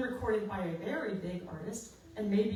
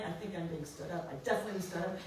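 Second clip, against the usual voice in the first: low, soft self-pitying moan; overly dramatic; 5 semitones lower, 5 dB quieter, faster than usual. Retold or imitated. Stood-up singer moping to herself, overly dramatic. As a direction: mope it out like a tragic heroine. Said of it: "Then you start getting overly dramatic".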